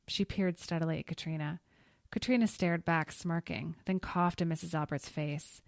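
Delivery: ordinary delivery, nothing marked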